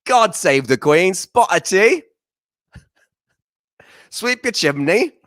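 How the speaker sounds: high, loud cartoon British greeting; mock British accent. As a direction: ham up a British accent for a clueless mom. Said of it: "how you say hello in British".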